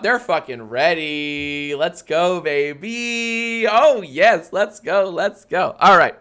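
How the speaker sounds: hyped, high game show host; upbeat cruise director. Acted. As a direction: pump up the crowd like a host. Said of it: "game show host voice".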